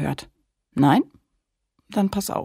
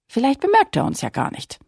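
hopeful little mermaid; 9 semitones higher, faster than usual. Acted. Lilli hopeful, excited child voice.

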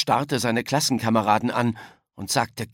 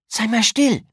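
high, sharp cut-in; urgent, louder shushing; interrupting hush; 11 semitones higher, 5 dB louder than usual. A narrator's reading. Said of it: "unterbrach Yolanda ihren Freund".

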